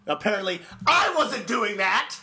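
indignant shout. Acted. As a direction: yell it like you've been wronged. Mock indignant outburst.

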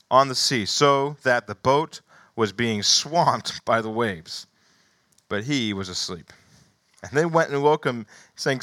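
animated scripture reading. Acted. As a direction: read the passage to the congregation. Reads Gospel passage aloud.

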